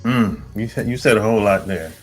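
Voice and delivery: normal talking voice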